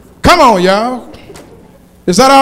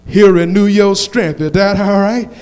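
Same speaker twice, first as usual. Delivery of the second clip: preaching at full force; 6 dB louder, slower than usual. Acted. Preacher rallying the congregation, full-force whooping sermon.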